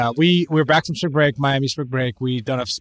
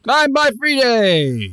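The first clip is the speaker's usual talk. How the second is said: higher, slow sing-song name; drawn-out high intro; 10 semitones higher, 3 dB louder, much slower than usual. Acted. Introducing himself on the podcast in a slow, high voice. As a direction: stretch your name out like a game show host.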